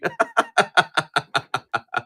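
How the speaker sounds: long chuckle, gradually falling; helpless run of laughter winding down. Real, not acted. Shipping adult underwear all over the country, helpless laugh.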